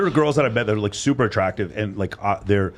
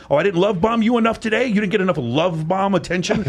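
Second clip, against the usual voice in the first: sarcastic mock; 8 semitones higher than usual. Mocking partner demanding love bombing.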